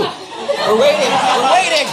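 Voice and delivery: high, loud mock announcement; insistent shout. Telling crowd they're waiting for that too, shouted. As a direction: holler it out to the whole crowd. Answering a chant to kiss.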